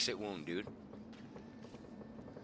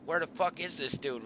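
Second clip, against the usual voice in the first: retold irritation, pitched up; pissed off; 7 semitones higher than usual. Hunter looking for his missing buddy, retold as pissed off. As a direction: replay the exasperated question. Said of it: "So now I'm pissed".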